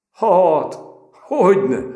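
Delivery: high, loud, laughing reply. A narrator's reading. The recruit laughing back at Péter, amused. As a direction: laugh it off as you answer. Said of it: "mondta Apagyi felnevetve".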